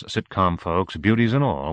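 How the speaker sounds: usual speaking voice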